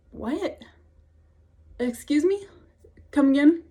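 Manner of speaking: high, rising incredulous questions; acted-out double take; stunned disbelief. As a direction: imitate being baffled, a beat between each question. Acting out their disbelief at news.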